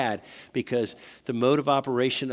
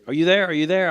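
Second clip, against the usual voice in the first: calling out on the phone; 7 semitones higher, 9 dB louder, faster than usual. Retold or imitated. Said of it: "And he's like".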